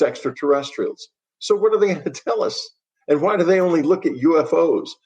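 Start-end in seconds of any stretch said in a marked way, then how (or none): 1.4-4.9 scornful disbelief. Real, not acted. Mocking NASA's UFO stance.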